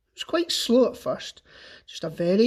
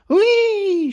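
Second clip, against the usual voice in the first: high, loud squeal of glee; gleeful scream; 11 semitones higher, 8 dB louder than usual. A narrator's reading. Grandma squeals as the dose kicks in. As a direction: shriek it out high with joy. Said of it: "she screamed".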